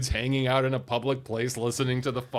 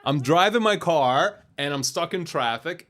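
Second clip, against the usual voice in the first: ordinary talk, nothing marked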